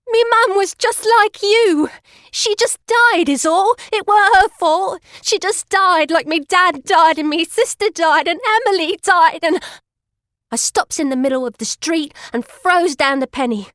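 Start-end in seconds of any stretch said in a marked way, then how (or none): 0.1-9.6 high, loud shout choking on tears; tearful shout. Acted. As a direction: cry it out as grief breaks through. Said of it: "and I shouts".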